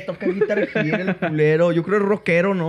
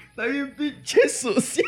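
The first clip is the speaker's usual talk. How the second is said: laughing tease; 6 semitones higher, slower than usual. Real, not acted. Teasing about the scarf.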